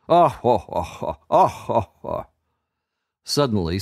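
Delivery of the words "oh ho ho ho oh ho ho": mocking evil chuckle; villain laugh. A narrator's reading. Enchanter laughing at the child at his feet. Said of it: "and laughed".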